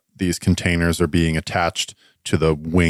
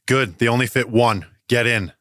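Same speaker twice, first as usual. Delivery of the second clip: higher-pitched hurried orders; raised yell; 6 semitones higher than usual. Acted. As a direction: call out orders in a mild, high yell. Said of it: "looks at you and yells".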